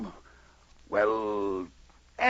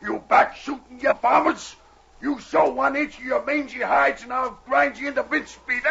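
roaring curses, much higher and louder; furious bellow; 13 semitones higher, 5 dB louder than usual. Acted. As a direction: bellow your rage at the cowards through the trees. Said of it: "his voice bellowed through the forest in futile fury".

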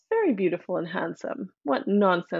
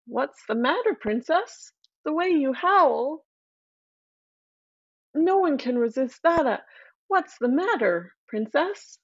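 higher, playful voice for the frog calling out; a lightly voiced friendly frog; 6 semitones higher than usual. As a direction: coax the crying princess from the water. Frog voice speaks from well.

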